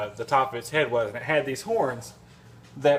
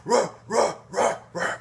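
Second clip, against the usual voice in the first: dog bark imitation; 6 semitones higher than usual. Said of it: "it's just".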